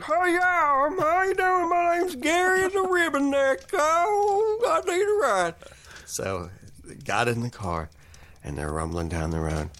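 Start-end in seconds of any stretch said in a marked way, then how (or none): 0.1-5.6 very high squeaky voice; silly character voice. Acted. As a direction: squeak it like a cartoon goofball. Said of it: "the guy goes".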